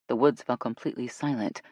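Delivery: plain reading voice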